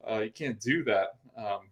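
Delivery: ordinary talk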